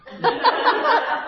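high, real burst of laughter; high amused laugh. Real, not acted. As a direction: let out a gleeful laugh at yourself. Laughs at her own irony.

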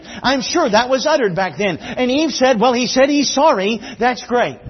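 punchy, high-energy sermon delivery; full-force preaching. Real, not acted. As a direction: drive it out to the whole congregation.

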